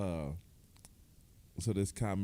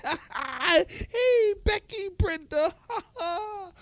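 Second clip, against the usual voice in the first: mock flirting manager; 5 dB louder, slower than usual. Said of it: "And he's like".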